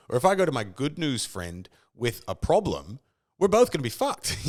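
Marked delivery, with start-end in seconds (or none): 3.4-4.5 high, loud amused punchline; half-laughing exclamation; laughing exclaim. Real, not acted. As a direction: blurt it out laughing. Joking punchline on good news friend.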